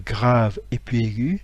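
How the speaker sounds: ordinary delivery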